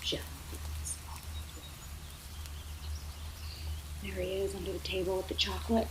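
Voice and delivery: hushed story whisper; suspense whisper. A narrator's reading. Narrator reading a spooky moment to a child, whispered.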